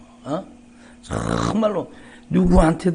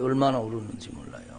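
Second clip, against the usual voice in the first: 얼마나 울었는지 몰라요 repeated much softer and quicker; soft echo; 5 semitones lower, 8 dB quieter, faster than usual. Shown as a demonstration. Pastor echoing his own weeping line, softer and quicker. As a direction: drop to a hushed aside.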